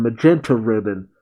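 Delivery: ordinary talk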